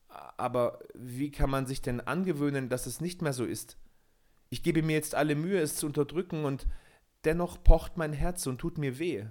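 put-on Peter, young and anxious. A narrator's reading. Peter klagt Michel sein Leid. (Peter klagt Michel sein Herz, höher und flehend)

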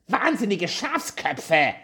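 high, slow, rasped insult; snarling dwarf. A narrator's reading. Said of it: "schnarrte der Zwerg".

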